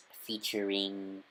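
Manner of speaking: usual speaking voice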